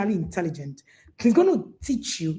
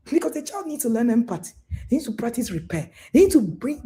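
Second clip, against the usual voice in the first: ordinary delivery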